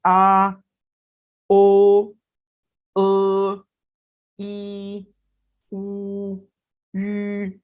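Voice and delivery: each vowel said alone, clearly and carefully, Chinese style: "आ", "ओ", "अ", "ई", "उ", "यू". Shown as a demonstration. Teacher modelling the vowels आ ओ अ ई उ यू for students, with each vowel said clearly. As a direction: model the vowels आ ओ अ ई उ यू one by one with pauses between them. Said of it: "अगर मैं Chinese में अदा करूं तो इस तरह से बोले जाएंगे".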